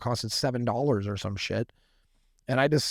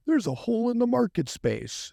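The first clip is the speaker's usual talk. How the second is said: much higher, mocking Ivy League analyst tone; mock business-school voice; 12 semitones higher than usual. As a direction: send up a polished corporate analyst. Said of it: "I'm not a polo shirt wearing ... Ivy League school having".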